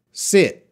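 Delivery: short lax i in "sit". Shown as a demonstration. Teacher modelling "sit" for the class. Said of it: "one last time".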